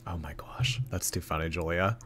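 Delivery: ordinary talk, nothing marked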